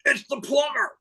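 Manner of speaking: very high, strained-patience call; hammed-up exasperation, pitch shooting up; agitated plumber. Plumber answering the parrot yet again, agitated high call. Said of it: "Guy's getting increasingly agitated".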